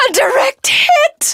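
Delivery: high, loud gleeful shout; shrill thrilled exclamation; gleeful cry. Acted. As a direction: cry out in delight at the hit. Villain sees missile strike.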